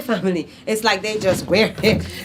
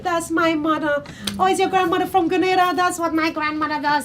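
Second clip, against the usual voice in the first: high, excited mock recognition; imitating a thrilled audience member; audience delight; 11 semitones higher than usual. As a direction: gush like a delighted listener. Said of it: "people are like".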